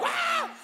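high-pitched imitated child scream; kids' squeal. Imitating kids seeing the house, high squeal. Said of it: "the kids are like".

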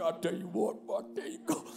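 low, subdued man's mumble; understated husband. Retold or imitated. Imitating the husband seeing his house, low understated reaction. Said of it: "the husband's like".

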